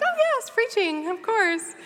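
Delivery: high-pitched, drawn-out breezy agreement; mock eagerness. Speaker joking about agreeing to preach, mock eager. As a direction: put on a sing-song, high "of course".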